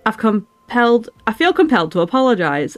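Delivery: put-on game character read in a northern English accent. Streamer voices a game character's dialogue, northern English accent. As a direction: put on a Lancashire-leaning northern accent, formal speech. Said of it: "I need to do his accent!".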